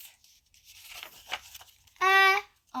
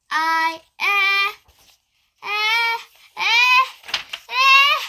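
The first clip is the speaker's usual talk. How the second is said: rising higher and louder each eh; building sneeze; 6 semitones higher, 11 dB louder, slower than usual. Acted. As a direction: wind up to a sneeze.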